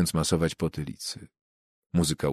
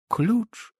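much higher, blank rising echo of the word; dumbfounded echo. Acted. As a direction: repeat it as if your mind just went empty. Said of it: "momentalnie głupiejąc". (zgłupiałe, wysokie powtórzenie)

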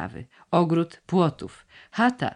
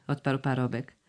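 usual speaking voice

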